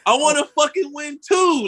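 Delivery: high, loud burst of envy; a sudden high yell of wanting in; frustrated outburst. Real, not acted. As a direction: blurt it out, half shouting. Frustrated outburst about missing gains.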